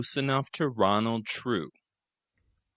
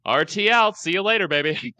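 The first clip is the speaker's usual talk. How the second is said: high, loud sing-song goodbye; cocky farewell; 10 semitones higher, 7 dB louder than usual. Retold or imitated. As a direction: toss it off like a smug charmer. Ronald's cheeky farewell.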